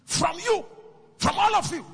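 rising preacher's shout. Acted. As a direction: hurl it out at the whole crowd.

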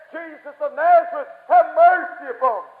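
Bartimaeus crying out. Acted. Beaten blind beggar calls to Jesus, crying out. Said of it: "cried out again".

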